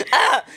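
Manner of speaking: laughing half-scream; laughing mock wail. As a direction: cackle into a yell.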